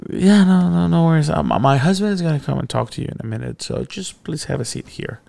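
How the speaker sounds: high, sweet, fast hostess. Retold or imitated. Boss's wife reassures the scared teacher in a sweet voice. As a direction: imitate the polite wife. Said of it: "I was like".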